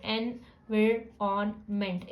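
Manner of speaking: Environment slowly, chunk by chunk; split into syllables. Shown as a demonstration. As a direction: break environment into its parts, slowly. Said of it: "इन तीनों को एक साथ पढ़ो".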